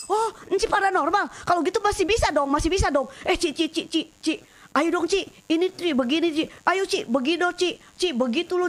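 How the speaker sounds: high, eager nagging from the mothers; pushy pleading. Retold or imitated. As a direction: press her eagerly, over and over. Ibu-ibu mendesak minta dibantu. (ibu-ibu mendesak penuh semangat dengan nada tinggi)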